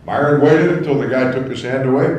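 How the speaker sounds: ordinary talk, nothing marked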